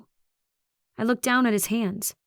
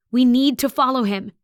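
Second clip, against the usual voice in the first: loud, higher-pitched plea; raised, desperate voice; urgent insistence; 4 semitones higher, 6 dB louder than usual. A narrator's reading. Urgent argument after the chase.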